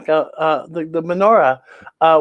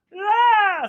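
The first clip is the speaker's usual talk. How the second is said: high excited yeah; high cheer; 13 semitones higher than usual. Acted. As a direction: nonno esulta. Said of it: "says Grandpa Latka".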